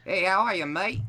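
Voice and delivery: high, loud Aussie greeting; eager amateur Australian accent; attempted Australian accent. Shown as a demonstration. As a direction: copy an Australian "mate" greeting. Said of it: "just try to say something like".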